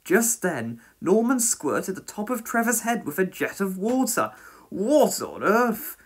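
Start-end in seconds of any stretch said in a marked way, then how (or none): 4.7-6.0 higher, drawn-out startled exclamation; mild yell of surprise, stretched out. A narrator's reading. Trevor squirted with a jet of water, startled yell. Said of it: "yelled Trevor".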